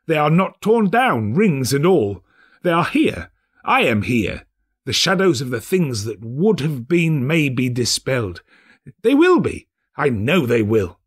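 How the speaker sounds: rejoicing that it is not too late; overjoyed old man; excited Scrooge. Acted.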